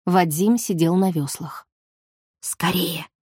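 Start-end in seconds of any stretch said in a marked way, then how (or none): 2.4-3.1 sharp, hushed hiss; urgent hiss. A narrator's reading. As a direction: hiss it urgently under breath. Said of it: "шикнула Галка".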